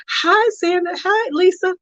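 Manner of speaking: high bright hi; sing-song greetings. Real, not acted. Streamer welcoming viewers by name, high. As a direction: lift each name high.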